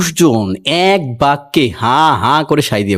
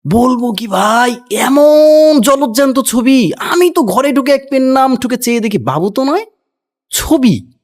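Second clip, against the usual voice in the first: pampered servant; 9 semitones higher than usual. A narrator's reading. Pet servant gushes to the others about the portrait, high and gushing. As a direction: gush like a spoilt house servant. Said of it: "এক একটা character আছে এখানে".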